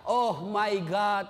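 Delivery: slow, drawn-out mock plea in English; hammed-up child praying, stretching out the words; mock English prayer. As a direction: stretch it into a comically pleading prayer. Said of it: "anak berdoa".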